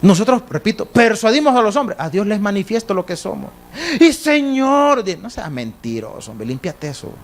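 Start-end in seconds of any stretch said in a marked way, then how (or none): 4.0-5.0 high, drawn-out mock plea; pleading sinner. Sinner begging God for rescue, exaggerated pleading. 5.0-7.1 low, softer mock scolding; dismissive rebuke. God answering a lying sinner, dismissive rebuke.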